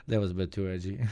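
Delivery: normal talking voice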